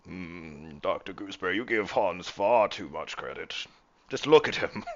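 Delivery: hammed-up accusing captain, cracking up; scornful captain. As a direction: start measured, end crowing with laughter. Game master plays scornful captain.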